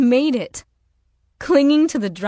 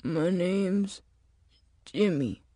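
straining voice; 5 dB quieter, slower than usual. Acted. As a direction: labour through it weakly, badly hurt. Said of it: "his voice straining".

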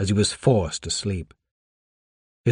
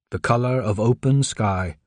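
ordinary delivery, nothing marked

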